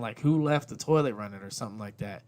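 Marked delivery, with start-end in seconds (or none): 0.2-1.4 raised-voice yell at the kids, played lightly; mild mock yell. Dad yelling about the toilet in his podcast intro, mock yell. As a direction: call it out like an annoyed parent. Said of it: "I'm yelling, like".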